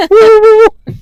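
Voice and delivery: very high, loud alien whoop through laughter; laughing alien woo. Pretend. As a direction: squeal the alien call again, laughing. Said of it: "That's my alien sound".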